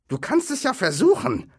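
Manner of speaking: high, loud troll reply; high-voiced troll. A narrator's reading. Bert answering Tom about cooking, high troll voice. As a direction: squawk the answer back as a troll.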